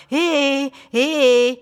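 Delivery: thin, high and loud mimicking squeak; mocking high voice. A narrator's reading. Randy Peck mocks Morris in a high, silly voice. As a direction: ape the boy in a silly high little voice. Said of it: "met een hoog en flauw stemmetje na".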